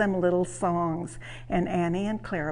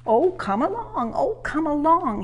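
much higher impatient puffing call; puffed impatiently; 8 semitones higher, 4 dB louder than usual. A narrator's reading. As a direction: hurry them along, impatient. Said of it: "he puffed impatiently".

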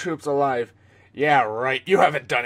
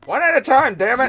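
yelling; 8 semitones higher, 4 dB louder, faster than usual. Real, not acted. Yelling at attacking units.

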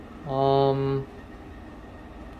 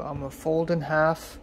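usual speaking voice

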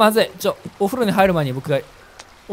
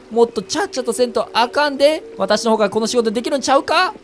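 higher fake Kansai taunt that builds to a loud snarl at the end; fake Kansai accent; 8 semitones higher, 4 dB louder than usual. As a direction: goad the clerk in put-on Kansai, then blow up. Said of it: "エセ関西弁喋って".

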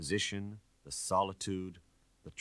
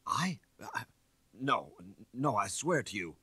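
stammering, a touch higher in fright; alarmed stammer; 4 semitones higher than usual. A narrator's reading. Denègre, unmasked, stammers his denial in alarm. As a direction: stumble over the words in alarm. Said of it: "started in alarm and stammered".

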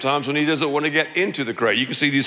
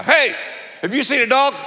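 hailing a stranger; 10 semitones higher than usual. Acted. Searcher hailing another guy about a dog, calling out. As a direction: call out a hopeful question.

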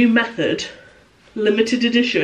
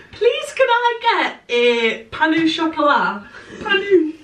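mock French accent; 6 semitones higher than usual. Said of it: "playing the customer".

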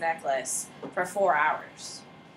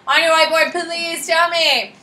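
higher, louder, stretched quiz call; game-show prompt; 6 semitones higher, 8 dB louder than usual. Real, not acted. Teacher calling the class to their whiteboards, hyped game-show host. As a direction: drag out "please tell me" like a quiz host.

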